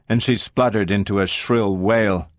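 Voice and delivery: usual speaking voice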